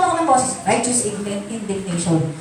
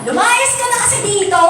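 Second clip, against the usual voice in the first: mock angry order; 8 semitones higher than usual. Shown as a demonstration. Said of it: "Hindi ka nagpapagalit na".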